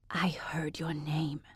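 soft unvoiced whisper, slow. A narrator's reading. Laura whispering to her friend about the family. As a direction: breathe it out secretly. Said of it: "she whispered".

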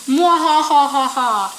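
slow, loud evil laugh; mad scientist. Retold or imitated. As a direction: cackle like a cartoon villain. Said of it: "It says".

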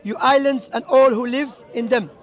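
ordinary talk, nothing marked

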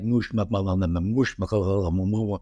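imitating Slavic-sounding prayer. Imitating the old woman praying in the car, lightly voiced babble. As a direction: babble fake Slavic syllables like a muttered rosary. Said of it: "it was, like, a Eastern European language".

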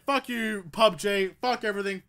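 usual speaking voice

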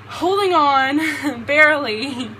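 loud, slow, laughing through the words. Real, not acted. Laughing loudly while reading her note to her mother-in-law. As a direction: burst out laughing while finishing the line.

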